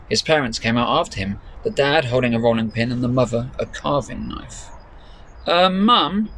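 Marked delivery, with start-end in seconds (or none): 5.5-6.3 put-on higher girl's voice, hesitant; girl trying to get her mother's attention; uneasy Claire. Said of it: "said Claire".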